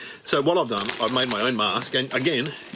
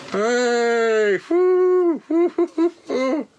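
loud high whooping, higher and louder; whooping cheer; 10 semitones higher, 4 dB louder, much slower than usual. Pretend. Comic shows kids his smoker's mask, whooping loudly. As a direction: whoop it up like a kid showing off. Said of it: "Check this out, kids".

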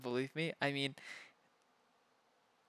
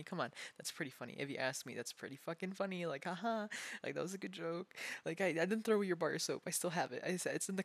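ordinary talk

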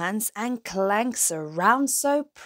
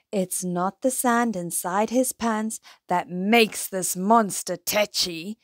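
ordinary delivery, nothing marked

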